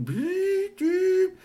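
very high electronic bleep sounds; imitated bleeps. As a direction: beep like a gadget. Imitated device beeps.

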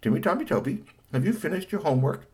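a hint of mum checking up; lightly voiced mother. A narrator's reading. Mother asks son about homework, lightly voiced. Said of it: "His mother said".

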